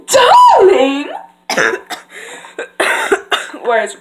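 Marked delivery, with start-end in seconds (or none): 0.1-1.1 high, loud, drawn-out call; Rarity-style impression. Fashion pony calling out, drawn-out theatrical cry. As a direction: sing it out grandly, high. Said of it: "next is Rhodey".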